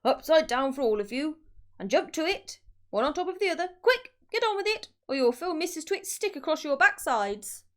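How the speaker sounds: fearsome monkey trainer. A narrator's reading. Mister Twit orders the monkeys to stack, loud bullying trainer. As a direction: drive them like a cruel ringmaster. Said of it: "fearsome monkey trainer's voice".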